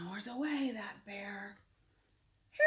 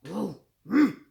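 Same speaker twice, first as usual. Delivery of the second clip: grumbling; 14 dB louder than usual. Acted. Grumpy bear noise.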